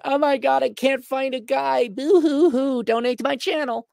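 hammed-up boo hoo whining; fake crying. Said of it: "the equally Jerry Springer".

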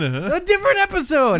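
high-pitched, insistent repeat; exasperated exclamation. Acted. Putting off co-host who asks when, high exasperated exclamation. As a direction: push it up high, protesting.

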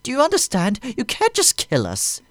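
high, loud panicked begging; terrified pleading. A narrator's reading. Aide begging Vader on the floor, high panicked plea. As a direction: plead for your life.

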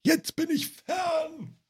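high, slow, drawn-out puppet voice; a hammed-up, stretched-out Grobi call. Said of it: "wo der immer so wegläuft".

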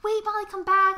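high, loud call; mock calling after. Retelling Angela calling after fleeing Molly, loud mock plea. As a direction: call out to stop her running. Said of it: "she's like".

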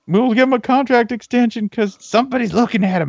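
high, loud possessive gushing; jealous school voice. Retold or imitated. A school bragging about keeping its coach, high and loud. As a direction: gush over him like a jealous lover. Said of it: "That's my favorite thing when a school does that".

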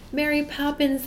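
high call after someone far off. A narrator's reading. Children calling to Mary Poppins in the sky, high pleading call. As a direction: cry out to bring her back. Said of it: "they cried".

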